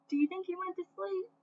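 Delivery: put-on high, quick, soft question to kids; teacher asking the listeners; playful question. Shown as a demonstration. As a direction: tease the class with the question. Teacher asks the children.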